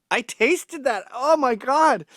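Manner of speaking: imitating a panicked, high, loud gasp of words. Maced friend tasting the spray, in mock panic. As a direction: squeak it out like he's just been maced. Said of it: "And he's in a panic".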